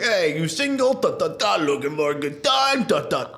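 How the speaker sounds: put-on high, cheesy Italian-accented flirting; hammed-up Italian flirt. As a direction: lay on a sleazy Italian charm. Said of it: "be like".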